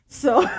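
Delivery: loud high laugh; burst of laughter. Real, not acted. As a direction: break into a big laugh.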